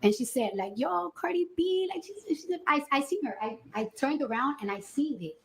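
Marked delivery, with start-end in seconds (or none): 0.8-3.4 excited quote. Retold or imitated. Guard filming the celebrity on her phone, excited. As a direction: imitate someone spotting a star, stretching it out.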